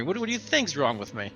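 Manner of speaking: ordinary talk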